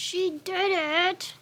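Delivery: high, slow praise; proud praise. Real, not acted. Praising her cat for finishing the nail trim, high and slow. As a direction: sing it out proudly.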